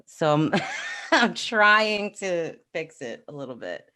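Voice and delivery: ordinary delivery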